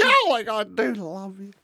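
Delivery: high, slow gushing Australian diva; Dame Edna Everage. Acted. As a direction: falsetto parody of a grande dame. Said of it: "That's Dame Edna Everage".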